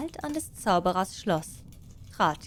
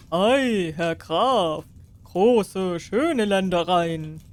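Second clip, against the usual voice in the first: louder, slow admiring royal voice; impressed king; 5 dB louder, much slower than usual. Acted. King admires the count's lands, louder and slow. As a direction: marvel loudly as the king.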